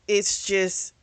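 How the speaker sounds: ordinary talk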